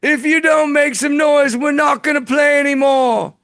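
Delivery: high shouted rock star stage call; [name]. Retold or imitated. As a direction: yell at the crowd like [name]. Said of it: "[name] keeps on saying".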